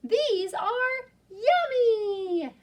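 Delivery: high, very slow exclamation of joy; delighted. Acted. Young yak discovering food is tasty, high slow delight. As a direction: savour each word in delight. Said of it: "said the young yak".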